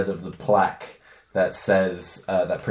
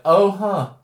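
surprised; 9 semitones higher, 6 dB louder than usual. Retold or imitated. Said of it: "And then she's like".

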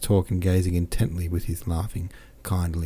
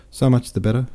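lifted, louder so much the better, rising at the end; smiling captain, bright first take; 5 semitones higher, 5 dB louder than usual. Acted. Captain cheers Pierre, the louder rising first take. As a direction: beam it out, rising. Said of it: "he added, smiling".

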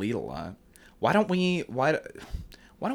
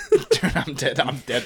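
laughing; 5 semitones higher, 7 dB louder than usual. Retold or imitated. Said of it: "it's like".

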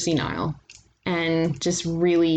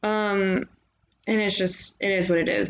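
ordinary talk

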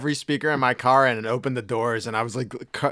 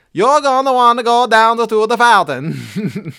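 Italian woman; 10 semitones higher, 9 dB louder than usual. Retold or imitated. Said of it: "She's like".

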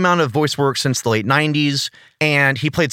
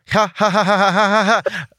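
high, deliberate fake ha-ha laugh; mock laugh; 7 semitones higher than usual. Host answering co-host's early laughing, mock laugh. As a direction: laugh on purpose in evenly repeated high ha-has.

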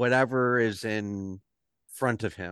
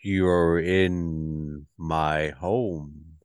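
lower, slow Goliath voice; slow, deep giant voice; 6 semitones lower, slower than usual. Acted. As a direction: speak slow and low like a huge half giant.

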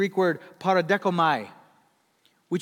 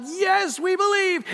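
much higher and louder, a cheering crowd; crowd shouting; 12 semitones higher, 6 dB louder than usual. Retold or imitated. Crowd answering Blondin, cheering shout. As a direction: yell it like fans at the falls. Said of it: "And they would shout".

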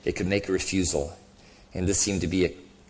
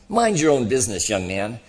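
higher, huffy telling-off; indignant rebuff; 4 semitones higher, 5 dB louder than usual. A narrator's reading. Ghost rebuffing talk of his family, indignant. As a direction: put him sharply in his place.

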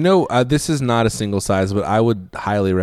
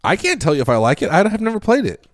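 defensive protest; 11 semitones higher, faster than usual. Real, not acted.

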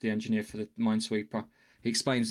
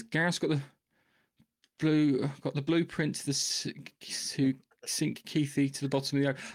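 ordinary talk, nothing marked

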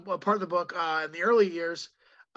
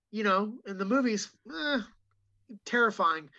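ordinary delivery

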